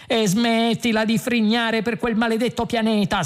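high, exasperated outburst; irritated snap without much added volume; snapped. A narrator's reading. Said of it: "sbottò Ford".

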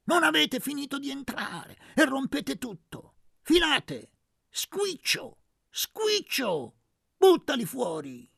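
high, loud yell; shouting. Acted. Aunt yelling as a vase shatters, shouting. Urlò zia Maddalena.

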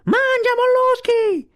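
very high, drawn-out taunting yell; shrieked, slow insult; shouting with all might. A narrator's reading. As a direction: yell it high, stretching the words. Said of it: "gridò più forte che poté".

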